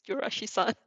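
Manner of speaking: plain reading voice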